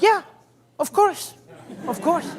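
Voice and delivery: high, laughing eager of course; a kid nodding along too eagerly; nervous son agreeing. Retold or imitated. Boy promising the producer secrecy, high laughing yes. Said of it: "He goes".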